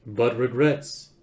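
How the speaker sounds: slow, measured announcing voice; courteous old recording. A narrator's reading. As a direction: announce politely with a hint of menace. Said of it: "continued the voice".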